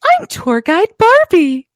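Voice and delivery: high-pitched mimicked Barbie accent; changed accent. Teasing co-host imitates her trip line, high put-on accent. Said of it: "I love when the accent changes".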